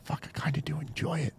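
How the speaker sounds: hushed unvoiced aside; mock confession under the breath; whisper. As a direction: whisper it like a guilty secret. Confessing quietly to wife.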